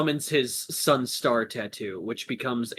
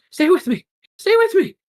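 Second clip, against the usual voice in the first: high, loud desperate shouts; panicked urging; 9 dB louder, faster than usual. Acted. Gecko lifting wounded Ladon, panicked urging. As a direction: shout to keep him conscious. Rescuer begging dying friend.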